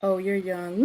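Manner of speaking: ordinary talk, nothing marked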